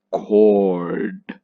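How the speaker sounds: Cord drawn out long and clear; stressed syllable. Shown as a demonstration. Teacher blending the sounds, Cord long and stressed. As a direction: stretch Cord with full weight. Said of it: "This is the stressed one".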